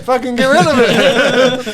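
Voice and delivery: laughing quote. Retold or imitated. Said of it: "I was like".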